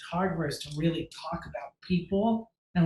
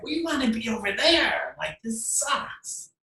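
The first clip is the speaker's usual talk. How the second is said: whiny complaint; 6 semitones higher, slower than usual. Retold or imitated. Jealous models on the other set.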